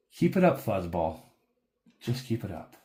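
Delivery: a hint of a dark rider's warning. Dark rider warning the companion, lightly voiced. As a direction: grumble a threat at him. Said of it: "and he goes".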